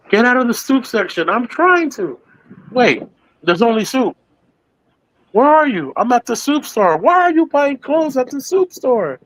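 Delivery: climbing squeaky cartoon voices; very high cartoon voices. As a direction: push the puppet voices way up. Replaying cartoon argument.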